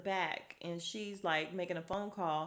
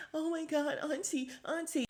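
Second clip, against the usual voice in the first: mock whimper, pitched way up; whiny overreaction; 8 semitones higher than usual. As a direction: imitate a man whining for his auntie. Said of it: "he sounds totally like a little bitch".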